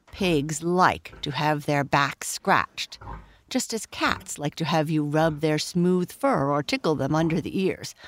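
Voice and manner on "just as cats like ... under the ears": ordinary delivery, nothing marked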